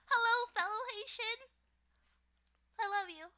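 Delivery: high, loud, delighted hello; excited greeting. Real, not acted. Streamer greeting a fellow Haitian viewer, excited.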